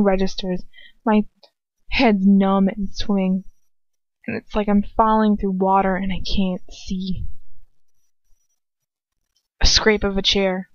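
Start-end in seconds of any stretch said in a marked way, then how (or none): none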